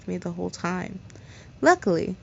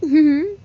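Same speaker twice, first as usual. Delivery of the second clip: mock bully laugh; 8 semitones higher, 6 dB louder than usual. Bully laughs after flirting.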